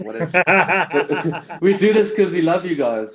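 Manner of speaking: high, loud laughing through the words; cracking up mid-sentence. Real, not acted. Laughing at his own failed Aussie accent joke.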